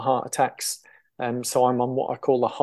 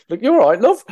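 put-on loud, high South London accent; a quick imitated South London accent; 9 semitones higher, 8 dB louder than usual. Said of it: "that's my poor attempt at a South London accent".

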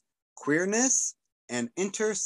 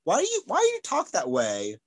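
higher, puzzled mock questioning; retold puzzlement, pitched up; imitating puzzled questioners; 7 semitones higher, 4 dB louder than usual. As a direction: imitate people quizzing your odd speech. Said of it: "And then people are like".

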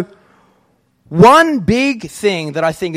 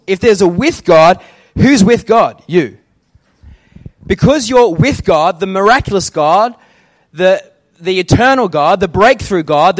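fiery preaching; 5 dB louder than usual. Acted. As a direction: preach it out to the congregation. Preaching at full force.

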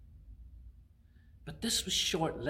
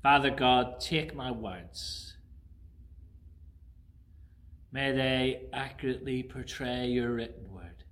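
prayer. Acted. Pastor praying over the congregation, reverent.

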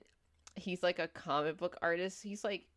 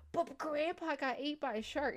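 much higher mock-kid plea; imitating protesting kid; 9 semitones higher than usual. Grounded kid insists to parents, high mock protest. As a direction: plead like a kid nobody believes.

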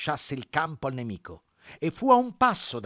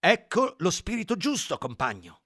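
higher, eager exclamation; exclaiming; 8 semitones higher, 6 dB louder than usual. Acted. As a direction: cry out approvingly. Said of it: "gridò Squillo".

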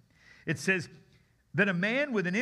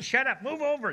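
higher, louder bickering voice; one demon shoving another aside; squabbling demon; 8 semitones higher, 3 dB louder than usual. Shown as a demonstration. Demon telling another demon to move over, higher and louder. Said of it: "there's lots of demons".

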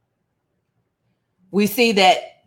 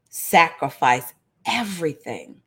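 ordinary delivery

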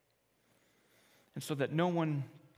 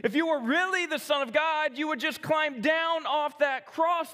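higher, louder sneering dare; mocking taunt; 8 semitones higher, 3 dB louder than usual. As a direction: jeer him to prove it. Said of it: "they said".

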